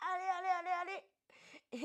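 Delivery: high, loud coaching push; urging on. Real, not acted. Coach spurring the class through the push, urgent cheering. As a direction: egg them on through the effort.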